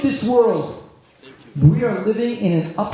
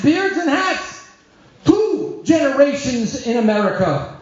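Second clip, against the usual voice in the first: higher, building insistence on beards and hats; impassioned answer; 8 semitones higher than usual. Retold or imitated. Son answers his mother, higher and insistent. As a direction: press the point home to mother. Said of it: "I said to my mother".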